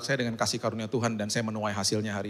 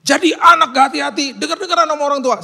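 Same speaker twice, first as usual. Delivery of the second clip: much higher, much louder angry rebuke; harsh scolding; 11 dB louder than usual. Pretend. Father attacking son's character, loud angry scolding. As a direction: lash out at the child's character. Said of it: "Saya nggak ngomong gitu".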